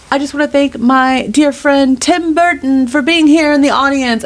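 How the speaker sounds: high, bright put-on Gaga voice, a bit fast; [name] voice. Wife mimicking [name] addressing the concert crowd, high put-on voice. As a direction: mimic a pop star greeting her crowd. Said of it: "giving her that voice".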